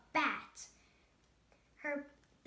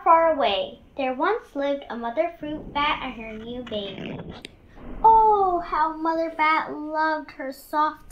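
put-on lively storybook narration for children; story reading; 3 semitones lower, 15 dB louder than usual. A narrator's reading. Reading Stellaluna to the class, warm storytelling voice. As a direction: read it like story time for kids. Reads picture book aloud.

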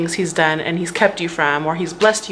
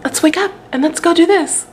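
much higher, bouncy mock-cheerful husband voice; perky morning husband; 10 semitones higher than usual. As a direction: imitate an overeager morning person, high and bright. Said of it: "But he's like".

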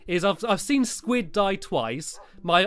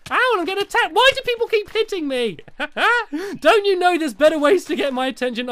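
high, whiny outburst; squealed mock-outraged griping; indignant protest; 10 semitones higher, 5 dB louder than usual. Real, not acted. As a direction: complain loudly while being pestered. Being hit by other players.

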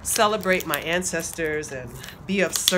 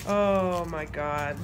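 tender awe; slower than usual. Real, not acted. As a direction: linger over it, deeply touched. Admiring the finished cards.

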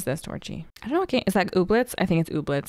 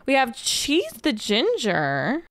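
ordinary talk

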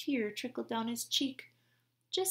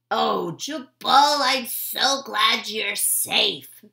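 relieved; 13 dB louder, much slower than usual. Acted. Gertie relieved hugging Jubal.

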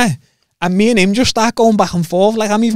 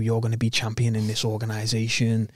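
soft, lower, coaxing promise; sweet nothings; 7 semitones lower, 9 dB quieter than usual. Retold or imitated. Boss sweet-talking the fighter with soft, low promises. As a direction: murmur it low like a smooth sweet-talker. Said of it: "he's been whispering all sweet nothings in me ear".

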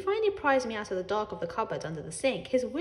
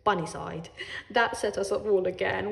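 usual speaking voice

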